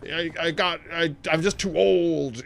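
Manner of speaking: lightly mimicked dying groan; dying person. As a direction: play a hammy dying old-timer. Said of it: "the dying is like".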